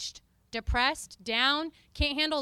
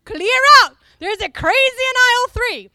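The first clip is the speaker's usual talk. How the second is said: much higher, much louder alarmed yell; panicked shouting shoppers; 9 semitones higher, 15 dB louder than usual. Retold or imitated. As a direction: yell a warning to the store. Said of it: "shoppers fleeing".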